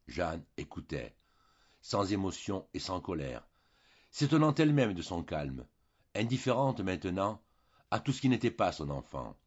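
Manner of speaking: ordinary delivery, nothing marked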